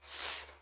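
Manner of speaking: स as a bare hissed s with no voice; just breathe out स through the teeth; unvoiced s. Shown as a demonstration. Teacher showing students the unvoiced s sound. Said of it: "जिसे बोलते हैं स".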